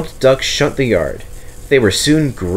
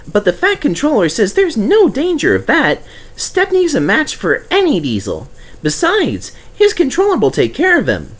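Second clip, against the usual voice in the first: high, eager little engine voice; Percy's voice; 13 semitones higher than usual. Acted. Percy reminding Douglas, in a high eager voice. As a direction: play the small cheeky tank engine.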